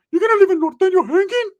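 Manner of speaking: very high, loud pleading squeal; exaggerated high-pitched imitation; Ricky. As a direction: protest in a goofy high cousin voice. Ricky pleads, before his deep voice.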